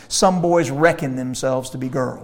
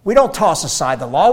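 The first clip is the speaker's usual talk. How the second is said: normal talking voice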